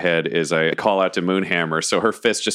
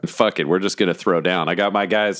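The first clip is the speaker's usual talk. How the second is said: usual speaking voice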